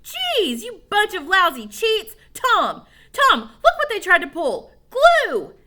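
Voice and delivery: loud, high, furious accusations; outraged screaming. A narrator's reading. Billy screams at the friends who cheated him. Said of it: "screamed Billy".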